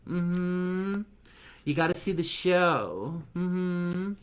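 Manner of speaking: put-on a man doing a high girly gossip voice; Michelle voice. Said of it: "Michelle talks like this".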